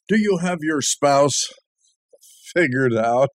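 normal talking voice

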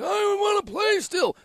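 put-on high, slow creaky old man; old guy voice. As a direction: play a frail old man. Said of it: "That's a good old guy voice".

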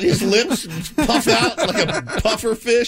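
high, slow, laughing while talking. Real, not acted. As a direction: laugh through the words. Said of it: "laughing at friend".

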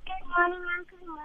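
faint, far from the phone; words barely coming through the line; barely audible. Real, not acted. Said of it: "You sound very faint".

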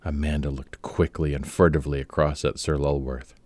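ordinary delivery, nothing marked

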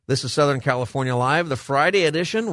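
bright station ID, lifted high; radio announcer. Acted. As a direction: announce the show with energy. Show ID announcement.

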